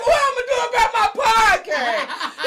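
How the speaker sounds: loud, high, slow wail through laughter; laughing outcry. Retold or imitated. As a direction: cry it out, half laughing.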